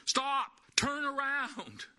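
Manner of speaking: put-on high, slow shout at a child near traffic; hollered warning. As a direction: call out Stop, drawn out and urgent. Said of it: "and you hollered at them".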